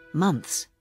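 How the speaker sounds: usual speaking voice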